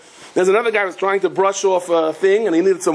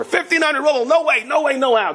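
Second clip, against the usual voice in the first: high-pitched, incredulous protest, falling by the end; outraged refusal; 10 semitones higher than usual. Retold or imitated. Rich man asked for fifteen hundred rubles, indignantly refusing. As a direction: balk at the price in mock outrage.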